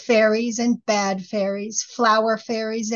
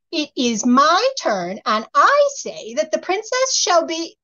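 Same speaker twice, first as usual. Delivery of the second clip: indignant fairy insisting; 8 semitones higher, 4 dB louder than usual. A narrator's reading. Fairy insists on her curse.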